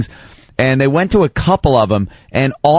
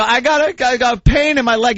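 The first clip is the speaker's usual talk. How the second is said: put-on whiny kid voice; 10 semitones higher than usual.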